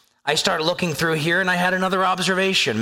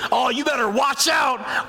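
higher, mildly shouted mock threat; mock angry warning; 6 semitones higher than usual. Preacher showing what anger makes you say, mock angry. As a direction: act out a flare of anger. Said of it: "I'm angry".

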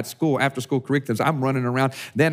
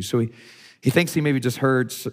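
usual speaking voice

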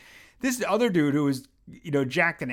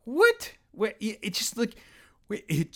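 can't believe what he sees; incredulous; 8 semitones higher than usual. Retold or imitated. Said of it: "And I'm like".

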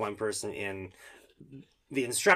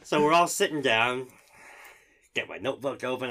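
ordinary delivery

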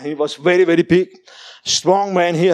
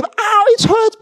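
much higher cry of pain; mock pained cry; 3 dB louder, slower than usual. Preacher voices the woman's cry, very high. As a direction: squeal it out like she is hurt. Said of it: "the lady".

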